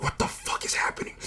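very soft, voiceless aside; stunned whisper. Retold or imitated. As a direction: whisper it in disbelief, as if to yourself. His stunned reaction amid the yelling.

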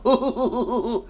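mock chuckle. Preacher imitating people who chuckle at scripture, mock chuckle. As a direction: put on a string of hoo-hoo chuckles. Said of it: "Sometimes they'll chuckle".

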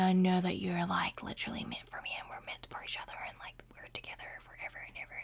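fading into a fast whisper; dreamy whisper. Retold or imitated. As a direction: whisper it like a starry-eyed girl. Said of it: "I was the girl that was like".

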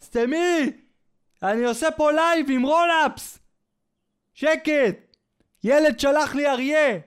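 high, irritated mock yell; husband yelling at wife. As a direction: snap at the wife from another room mid-livestream. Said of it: "הוא צועק לאשתו מה-, מהחדר". (בעל צועק לאשתו בעצבים)

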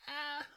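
high-pitched mock female moan. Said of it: "he sounds like a chick".

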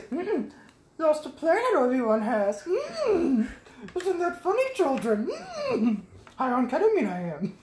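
high, croaky little Yoda voice, laughing; Yoda impression. Host does stoned Yoda to co-host, high and giggling. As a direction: squeeze up into a wizened Yoda, cracking up. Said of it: "and he goes".

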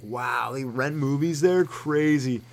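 slow, drawn-out amazement; mind blown. Acted. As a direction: marvel at it in a put-on voice. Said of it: "blows your mind".

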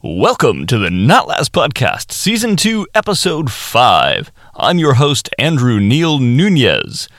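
hammed-up broadcaster delivery with a slip of laughter; radio broadcast voice. Shown as a demonstration. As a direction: announce it like a late-night radio show. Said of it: "let's try this as a radio broadcast edit".

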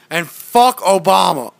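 loud, slow mock rant; mock shout. Mocking his friend's rant, a loud slow shout. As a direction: bark it out like a ranting guy.

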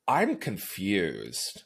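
low, slow, soft deadpan; hushed, drawn-out bewilderment; baffled. Retold or imitated. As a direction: say it slowly and quietly, at a loss. Acting out her baffled reaction.

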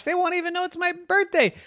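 squeaky high panicked inner voice; worried little girl. A narrator's reading. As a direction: fret fast like a child sure she'll be forgotten. Yvette's inner worry voiced.

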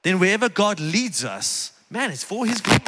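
ordinary delivery

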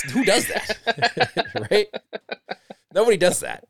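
high, laughing who-does-that scoff; laughing disbelief. Real, not acted. Host laughing at reposters, amused disbelief. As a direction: laugh it off as absurd.

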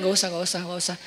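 low, soft, offhand brush-off; imitated proud refusal, fading away; feigned refusal. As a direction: decline half-heartedly, dropping the voice. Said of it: "Saya pura-pura gengsi gitu".

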